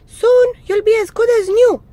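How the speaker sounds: very high, loud mouse voice; much too cheerful mouse. A narrator's reading. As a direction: overdo the chirpy cheer as a mouse. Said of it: "Mouse was much too cheerful".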